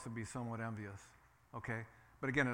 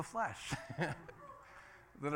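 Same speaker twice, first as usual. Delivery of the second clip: laughing; 4 dB quieter than usual. Real, not acted. Dry joke after listing sins.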